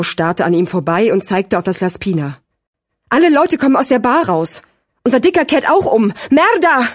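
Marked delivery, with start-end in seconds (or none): 3.1-6.9 high-pitched, building to a cursed exclamation; agitated alarm. A narrator's reading. Sirio sees their target turning back, agitated. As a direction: report it urgently, rising in agitation. Sirio reports plan going wrong.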